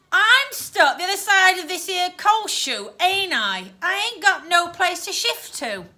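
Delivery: put-on rough working-class London accent; rough Cockney accent. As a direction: grumble in broad Cockney. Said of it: "the ghost's rough accent".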